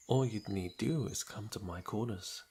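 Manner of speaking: ordinary talk, nothing marked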